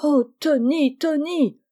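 high, lightly acted lament; tearful cry. A narrator's reading. Mother despairing of her son, tearful exclamation. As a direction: wail the name in mild distress. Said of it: "s'écria-t-elle en pleurant".

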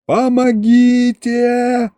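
high, slow, drawn-out cry for help; hammed-up wail of terror; mock panicked yell. A narrator's reading. Patient wails for help trying to hide, high and slow. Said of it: "заорал я".

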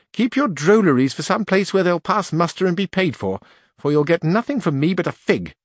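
put-on scornful old duenna. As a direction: send the fool packing.

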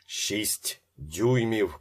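loud, high, stretched-out cry; drawn-out shout. A narrator's reading. Ben shouts the height to his son, drawn-out shout. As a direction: shout it slowly, stretching the words. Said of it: "кричав Бен Деві".

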